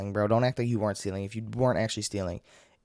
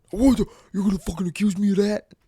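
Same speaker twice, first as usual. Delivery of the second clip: hammed-up indignant yell, pitched way up; mock explosive outrage; 11 semitones higher, 8 dB louder than usual. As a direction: imitate an outraged shopper, high and loud. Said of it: "they explode".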